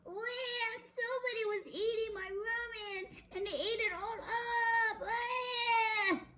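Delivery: very high mock-crying wail; Baby Bear. Baby Bear finding his ramen gone, mock wailing. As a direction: wail like a tiny bear, hammed up.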